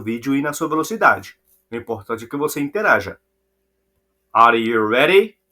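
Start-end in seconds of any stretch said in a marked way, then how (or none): 4.3-5.4 loud, cheery question to the learners; upbeat call to the class. Acted. Teacher to students, loud and cheery. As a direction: pump up the students before the quiz.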